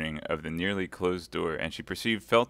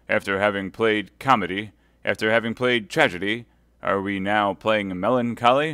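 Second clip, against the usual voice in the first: mocking nobleman, louder; sneering lord; 2 semitones higher, 8 dB louder than usual. Lord de Winter mocking his prisoner Milady, louder. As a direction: taunt the prisoner with scorn.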